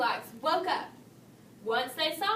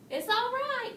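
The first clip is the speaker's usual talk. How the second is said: much higher call; 11 semitones higher, 3 dB louder than usual. A narrator's reading. Mama and Papa Bear calling after Goldilocks, higher call. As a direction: call out kindly after her. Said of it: "called Mama and Papa Bear".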